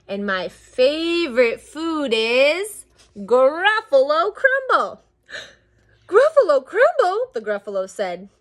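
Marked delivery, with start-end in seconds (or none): 0.1-5.0 slow build to a loud, high punchline; drawn-out threat. Acted. Mouse threatening the Gruffalo, slow theatrical menace. 5.3-7.3 gasp, then very high, loud echo; hammed-up horror. Gruffalo horrified by the mouse, high loud mock terror.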